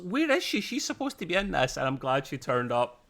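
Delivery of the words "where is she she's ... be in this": high-pitched, exasperated retold reaction; much higher, comic disbelief; mock indignation. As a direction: act out being baffled she's missing. Acts out own worried viewer reaction.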